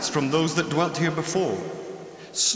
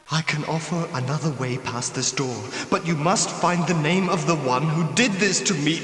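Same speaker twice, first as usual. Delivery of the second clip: usual speaking voice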